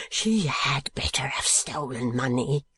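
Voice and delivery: slow whispered anguish; despairing whisper. Acted. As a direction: breathe it out broken. Gride despairing.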